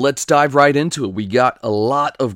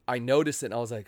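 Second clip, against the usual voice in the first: ordinary talk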